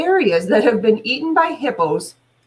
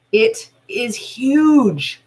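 drawn out very slowly for effect; slow emphatic; much slower than usual. Shown as a demonstration. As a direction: stretch every word to show how big. Teacher stressing the rhino's size.

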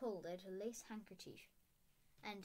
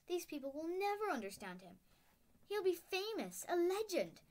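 higher, louder, passionate stern witch; indignant teacher voice pitched up, urgent; McGonagall objecting; 6 semitones higher, 6 dB louder than usual. A narrator's reading. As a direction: argue with growing fervour.

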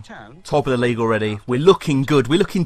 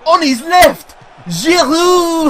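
high, loud rising commentary; excited shout; 12 semitones higher, 7 dB louder, much slower than usual. Real, not acted. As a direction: shout the name as he scores. Chance then goal scored.

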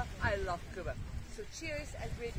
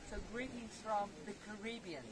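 quiet; 10 dB quieter than usual. Shown as a demonstration. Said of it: "not gonna shout".

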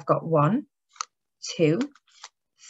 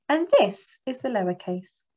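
usual speaking voice

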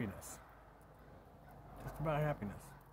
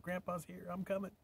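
put-on higher, eager kid voice; little girl voice; 8 semitones higher than usual. Granddaughter greeting grandpa, light kid voice. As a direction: chirp it like a toddler running over.

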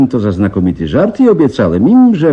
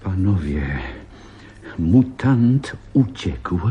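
slow, soft announcement; grave slow news; 5 semitones lower, 6 dB quieter, slower than usual. Acted. Professor telling the men the mutant escaped, slow and grave. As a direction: drop the news slowly and gravely.

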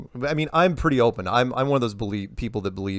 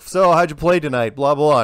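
louder, higher mock reporter question; 4 semitones higher, 8 dB louder than usual. Reporters badgering the basketball player, louder mock voice. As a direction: ham up a pushy reporter. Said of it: "it's like".

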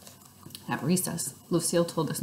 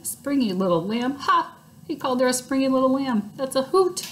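amused Junie; 8 semitones higher, 4 dB louder than usual. A narrator's reading. Junie laughing at Sheldon.

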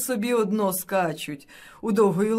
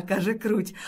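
usual speaking voice

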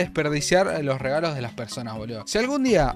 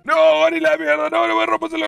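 high, loud panicked pleading; alarmed shout; 12 semitones higher, 6 dB louder than usual. Real, not acted. Player begging the gorilla not to kill him. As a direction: cry out as the gorilla hits you. Gorilla attacking his character.

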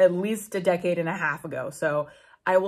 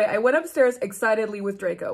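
ordinary delivery

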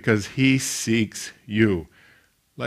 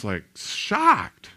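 ordinary talk, nothing marked

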